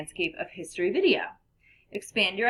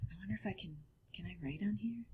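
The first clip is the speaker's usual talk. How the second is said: whispering to herself; 13 dB quieter, faster than usual. Real, not acted. Muttering to herself, testing the pen.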